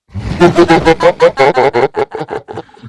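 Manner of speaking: loud high evil cackle; evil laugh. Pretend. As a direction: cackle like a villain. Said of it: "Give me your best villain voice".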